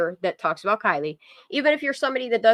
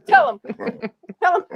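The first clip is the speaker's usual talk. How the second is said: much higher, loud, cheering the dog on; egging on the dog; 11 semitones higher, 6 dB louder, faster than usual. Real, not acted. Streamer cheers on her barking dog, high and loud. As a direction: hype her up.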